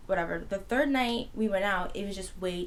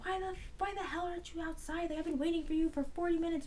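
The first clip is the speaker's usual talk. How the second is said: high, softer mock scolding voice; nagging mom; 9 semitones higher, 4 dB quieter than usual. Mom calls daughter after waiting outside, imitated high-pitched scolding. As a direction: imitate a fed-up mother on the phone.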